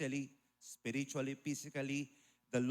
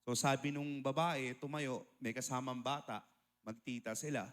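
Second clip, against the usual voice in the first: ordinary delivery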